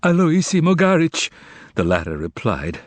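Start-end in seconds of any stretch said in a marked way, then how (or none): 0.0-1.3 high, frightened reply; trembling. A narrator's reading. Bewildered man fallen from the sky replying, trembling.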